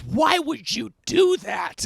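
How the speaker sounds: loud, high, slow mock outrage; upset teacher. Shocked teacher confronting the student, loud drawn-out outrage. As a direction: drag it out in a teacher's aghast yell. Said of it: "She goes".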